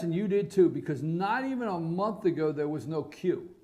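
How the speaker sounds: ordinary delivery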